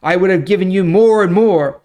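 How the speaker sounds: forceful scripture reading. Acted. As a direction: read it like God pronouncing judgment on a king. Lord's judgment through Nathan.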